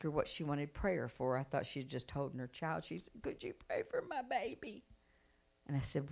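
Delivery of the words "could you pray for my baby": small, high, pleading voice; soft high-pitched imitation of a worried mother; mother's plea. Mother pleads for her baby.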